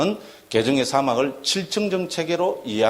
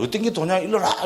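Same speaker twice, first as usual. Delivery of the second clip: higher-pitched, insistent quizzing demand; mock challenge; 6 semitones higher than usual. Challenger quizzing someone on what the path is, mock challenge.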